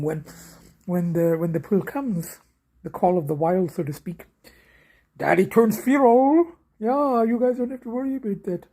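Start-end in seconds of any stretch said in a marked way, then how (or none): none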